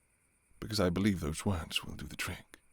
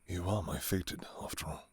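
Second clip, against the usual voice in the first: whisper; 9 dB quieter than usual. Acted. Intimate confession to beloved.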